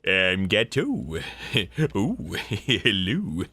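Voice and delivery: odd cartoon voice broken by giggles; original Gato voice. Shown as a demonstration. Voice actor demoing Gato's first pitched voice, giggling. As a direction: introduce yourself as the dim partner, laughing through it. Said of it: "the voice of Gato".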